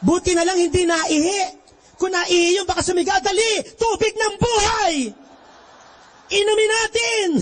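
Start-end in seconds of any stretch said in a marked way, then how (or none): 3.2-7.3 mock excited crowd. Crowd rushing for holy water.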